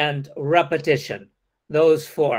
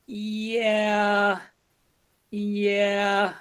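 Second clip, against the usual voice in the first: drawn-out, higher hesitant yeah; stretched-out yeah; 4 semitones higher, 4 dB quieter than usual. Shown as a demonstration. Teacher modelling a hesitant reply, long drawn yeah. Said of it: "that kind of stretched out".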